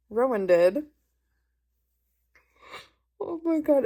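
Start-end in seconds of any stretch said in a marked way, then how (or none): 3.2-3.8 disgusted exclamation. Real, not acted. Recalling a gross scene.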